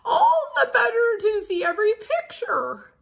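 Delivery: high, loud granny-wolf voice; wolf as grandma. Acted. Wolf pretending to be grandma to Red, high put-on voice. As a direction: squeak it out like a wolf faking a grandma.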